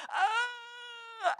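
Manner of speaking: imitating husband's high yelp of pain; mock pained yelp. Wife mimics husband's pained yelp at the needle. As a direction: squeal it like a fake stab. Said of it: "he's like".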